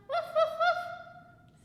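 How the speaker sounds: high, slow yappy woofs; dog barks. Pretend. Teacher barking to delight the kids, high and slow. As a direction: yip like a little dog. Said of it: "See, he likes it".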